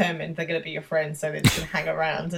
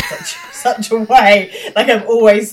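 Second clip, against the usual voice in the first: laughing; 4 semitones higher, 9 dB louder, slower than usual. Real, not acted. Laughing while talking.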